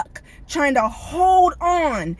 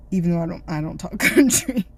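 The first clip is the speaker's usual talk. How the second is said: laughing; 9 semitones lower, faster than usual. Real, not acted. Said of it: "Give you a country accent".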